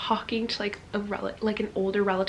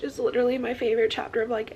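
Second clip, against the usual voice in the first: normal talking voice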